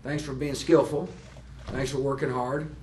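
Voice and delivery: ordinary talk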